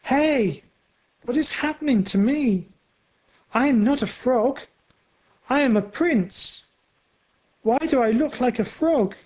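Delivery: high, fast panicked yelp; a prince panicking at turning into a frog; boy's scream. A narrator's reading. The Frog Prince cries out in shock, high and fast. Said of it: "a boy screams".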